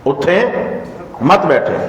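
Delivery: اٹھیں, a clear pause, then مت بیٹھیں; pause after اٹھیں. Shown as a demonstration. Teacher showing the class where to pause, a break after اٹھیں. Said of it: "اب اٹھیں کے بعد میں نے وقف کیا".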